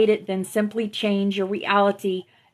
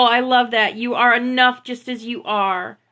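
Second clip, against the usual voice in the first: ordinary talk, nothing marked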